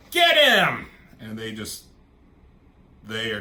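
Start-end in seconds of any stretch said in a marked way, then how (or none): 0.1-0.8 screamed high and loud; full-throated shout to attack; battle cry. Acted. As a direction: yell the charge at them.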